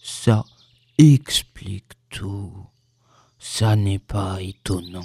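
deep, halting, gloomy donkey; sombre. A narrator's reading. Donkey resigned to his missing tail, sombre. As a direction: spell it out dolefully, low, with heavy pauses. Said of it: "dit Ian d'un air sombre".

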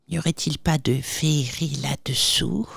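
chuchoté, méfiante; pensée chuchotée. A narrator's reading. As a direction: murmure tout bas ses soupçons. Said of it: "pensa-t-elle". (la cousine se parle à elle-même en chuchotant)

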